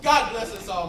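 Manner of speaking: loud, hearty blessing; a father's hearty Christmas toast. Acted. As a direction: raise the toast warmly. Bob toasts the family.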